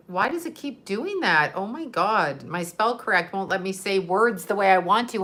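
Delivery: usual speaking voice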